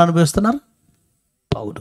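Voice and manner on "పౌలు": ordinary talk, nothing marked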